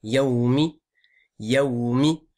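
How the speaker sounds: "Yaoumi" with long glide and clear i; careful rising "Yaoumi"; full ending. Shown as a demonstration. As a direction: finish "Yaoumi" on a sounded "mi". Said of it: "On dit pas Yao-, Yaoum".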